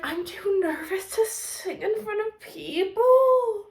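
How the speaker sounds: high, slow whisper; timid whispered confession; whispered shy quote. Retold or imitated. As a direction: breathe it out shyly. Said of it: "he was like".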